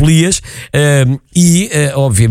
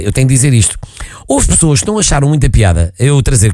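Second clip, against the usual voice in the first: ordinary delivery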